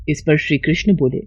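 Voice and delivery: plain reading voice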